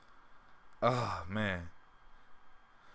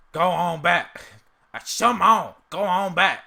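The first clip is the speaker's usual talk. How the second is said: loud, higher yelling at a dog; Uncle Ruckus impression; 7 semitones higher, 9 dB louder, slower than usual. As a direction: shout at the dog like a cranky old man. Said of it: "I can't even do the whole thing".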